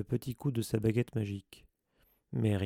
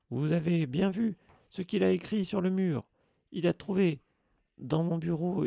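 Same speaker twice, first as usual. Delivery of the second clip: higher, louder furious caretaker; Filch's angry rant; 8 semitones higher, 4 dB louder than usual. A narrator's reading. Filch accuses Harry to Dumbledore, furious. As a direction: rage at the boy.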